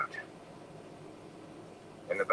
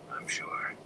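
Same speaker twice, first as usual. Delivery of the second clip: unvoiced hushed reply; whispering; 7 dB quieter than usual. A narrator's reading. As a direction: breathe the words out. Tam whispering in the dark.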